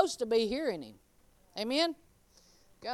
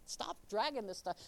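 ordinary talk

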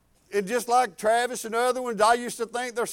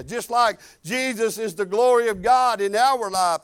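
ordinary talk

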